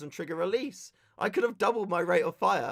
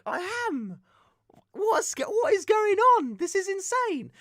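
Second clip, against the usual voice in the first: high incredulous squeak; mock outrage at the marshals; disbelief; 3 dB louder, slower than usual. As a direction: sound baffled and indignant. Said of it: "And I'm like".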